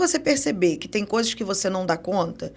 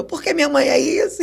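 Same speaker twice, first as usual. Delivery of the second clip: laughing; 6 semitones higher than usual. Real, not acted. Laughing while telling therapist.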